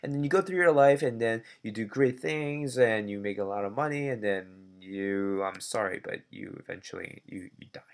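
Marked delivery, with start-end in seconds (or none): 6.0-7.8 hushed mock-grim whisper. Teacher whispering to class about dying. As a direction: drop to a whisper for the bad news.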